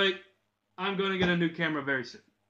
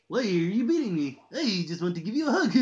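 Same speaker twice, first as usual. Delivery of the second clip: high, louder swooping villain voice; Joker; 6 semitones higher, 6 dB louder than usual. Acted. Villain to the one beating him, high clownish voice. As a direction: plead in mock hurt, breaking into a cackle. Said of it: "thinking about doing the Joker next".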